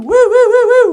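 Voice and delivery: loud, high wooing siren; vocal siren noise; imitated police siren. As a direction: wail like a cop car.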